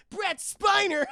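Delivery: high, loud shout breaking into laughter; gleeful reveal. Real, not acted. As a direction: blurt the name in delight.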